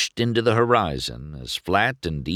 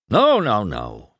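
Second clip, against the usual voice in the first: insistent denial; 3 semitones higher, 4 dB louder, slower than usual. A narrator's reading. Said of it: "he insisted".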